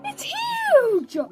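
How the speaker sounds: high-pitched amazed squeal; excited high squeal. Real, not acted. Reacting to the fallen branch.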